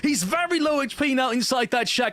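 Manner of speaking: shouting high hype call; esports caster. Real, not acted. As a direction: yell the clutch play. Live play-by-play.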